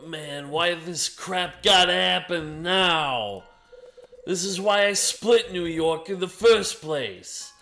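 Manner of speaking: fed up and loud; exasperated. Acted. Louis complains about trouble.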